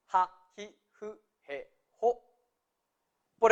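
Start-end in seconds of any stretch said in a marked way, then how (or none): 0.1-2.2 JA, JI, JU, JE, JO each with a sounded h; H pronounced. Shown as a demonstration. Teacher reminding learners of the ha column, h pronounced. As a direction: separate syllables JA, JI, JU, JE, JO, every h audible. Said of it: "Recuerden pronunciar la H".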